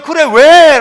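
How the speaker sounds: put-on loud, fond answer to a crying child; fond parent. God as a parent answering the crying child, loud and fond. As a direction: answer the child like a doting father. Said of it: "라고 하는 거예요".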